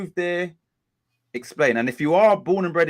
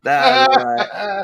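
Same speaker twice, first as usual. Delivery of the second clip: mock American accent; 11 semitones higher, 8 dB louder, slower than usual. Host mimics the accent back.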